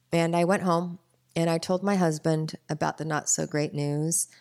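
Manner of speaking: ordinary talk, nothing marked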